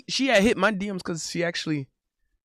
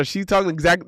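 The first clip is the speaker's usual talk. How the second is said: ordinary talk, nothing marked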